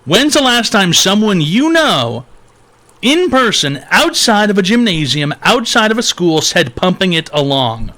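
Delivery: high, loud, disbelieving demand; incredulous grilling. Real, not acted. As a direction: press the caller in disbelief. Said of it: "incredulous host grilling caller".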